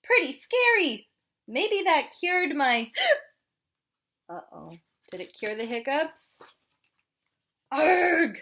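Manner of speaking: frustrated penguin with hiccups; hiccupy penguin despairing; penguin character voice. Acted. Penguin reacts.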